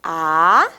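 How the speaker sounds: "A" stretched low, swooping up; drawn-out teaching syllable "A"; slow syllable. Shown as a demonstration. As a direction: hold "A" and lift it at the end. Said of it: "Vamos falar pausadamente?".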